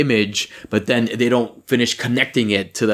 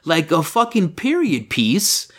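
exasperated; 5 semitones higher than usual. Real, not acted. Griping to a co-host about the period costumes, exasperated. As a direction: vent it, incredulous and fed up.

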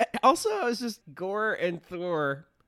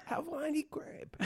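high, soft, drawn-out mock-Greek accent; hammed-up Greek accent; Russell Crowe; 8 dB quieter than usual. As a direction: croon it like a carefree Greek god. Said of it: "full Greek accent".

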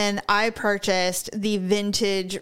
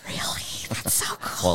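gasp, then whispered amazement; imitated awe; 9 semitones higher, 5 dB quieter than usual. Women hearing she critiques her husband, awed whisper. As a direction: whisper it in hushed mock wonder. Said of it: "They're all".

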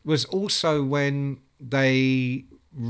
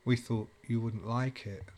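subdued; 3 semitones lower, 8 dB quieter than usual. Retold or imitated. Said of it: "they went".

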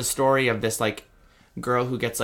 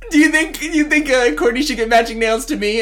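put-on very high, loud ditzy girl voice; 9 dB louder than usual. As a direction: squeal it like a starstruck teen. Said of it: "where she's like".

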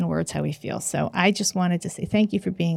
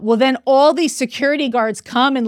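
usual speaking voice

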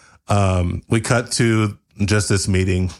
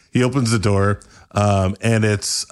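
usual speaking voice

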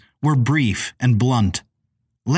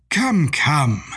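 put-on old gentleman, gently soothing; 3 semitones higher than usual. A narrator's reading. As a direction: coax her like a kindly old gent. Sir Shanley soothing her.

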